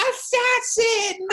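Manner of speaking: very high, slow, stretched cry; Jean Brodie's accusation. Retold or imitated. Guest imitates Jean Brodie accusing the girl, high and drawn out. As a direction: fling the word at the traitor. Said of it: "and you see".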